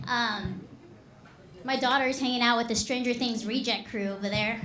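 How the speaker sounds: ordinary talk, nothing marked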